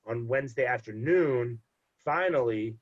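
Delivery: ordinary talk, nothing marked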